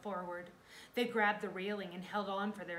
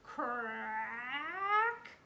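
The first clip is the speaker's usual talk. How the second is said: long, rising, louder word; loud crack; 5 semitones higher, 6 dB louder than usual. A narrator's reading. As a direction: stretch it like splitting wood. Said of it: "heard a loud".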